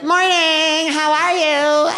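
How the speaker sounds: very high, drawn-out hello; chirpy parent greeting. Retold or imitated. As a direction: sing it out like an overfriendly parent. Parents greet him cheerily.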